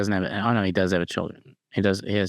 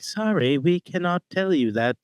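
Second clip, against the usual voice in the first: put-on higher-pitched robot with a British accent; 6 semitones higher than usual. Host imagines a robot refusing to share secrets, British robot voice. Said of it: "I don't know why I made him British".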